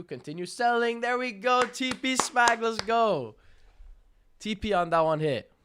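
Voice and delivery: high, loud trade celebration; excited shouting. Real, not acted. Streamer cheering a winning trade to chat, excited shouting. Live trade hits its target.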